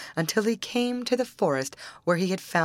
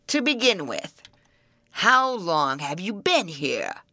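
troll voice. Acted. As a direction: growl it out like an old troll.

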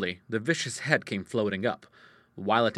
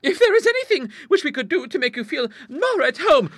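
very high, eager-to-please lady's voice; high-pitched genteel character; timid visitor; 10 dB louder than usual. Acted. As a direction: offer politely and nervously, pitched high.

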